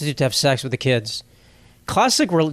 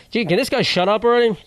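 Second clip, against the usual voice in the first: higher, mocking heckler voice; self-mocking; 7 semitones higher than usual. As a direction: heckle yourself in jest. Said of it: "I'm boring myself".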